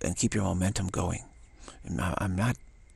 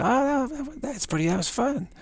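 put-on much higher kid voice; high childish voice, tickled; laughing child. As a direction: play a delighted little kid. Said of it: "starts laughing, say".

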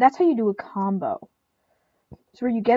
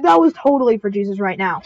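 ordinary talk, nothing marked

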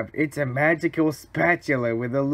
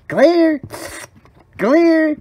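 high, loud medic's call; urgent shout; 11 semitones higher, 5 dB louder than usual. Acted. Medic reviving a buddy, shouting urgently. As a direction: shout it like using a defibrillator. Mock defibrillation.

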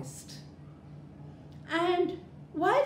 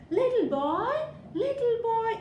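calling out; 11 semitones higher, 3 dB louder than usual. Acted. Mother calls her son.

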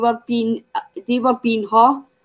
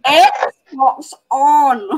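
"Xbox on" a little louder, broad Scots vowels; mock Scottish "Xbox on"; 4 semitones higher, 4 dB louder than usual. Imitating brother in his bedroom, mock Scottish "Xbox on". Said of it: "you hear him in the bedroom going".

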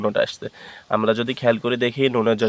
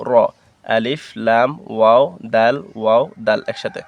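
ordinary delivery

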